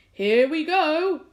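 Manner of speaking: high, loud, slow cry; old grasshopper shouting with excitement; excited shout. A narrator's reading. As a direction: whoop it out as the floor heaves. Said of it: "shouted the old green grasshopper".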